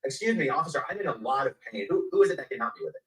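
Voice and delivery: loud call to an officer down the hallway; retold shouting. A detainee in pain calls out to an officer, shouting. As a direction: shout for help across the hall. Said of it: "And I started shouting".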